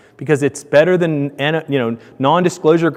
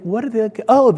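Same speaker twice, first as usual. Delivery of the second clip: puzzled realization; 8 semitones higher than usual. Retold or imitated. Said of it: "you think".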